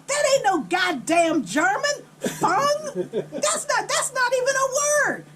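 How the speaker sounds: high, loud mock outrage; exasperated mom. Mom rejecting the made-up German word, hammed-up indignant yell. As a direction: imitate a fed-up mother, pitch shooting up.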